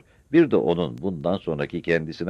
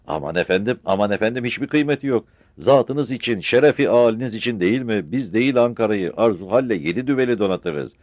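higher, louder fawning modesty; flattered official; 7 semitones higher, 5 dB louder than usual. Acted. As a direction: gush with humble flattery.

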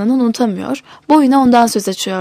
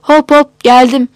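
calling back from afar; 2 semitones higher, 6 dB louder, slower than usual. A narrator's reading. Dealer answering a customer from the back, calling out loudly. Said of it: "Derinlerden bir ses cevapladı".

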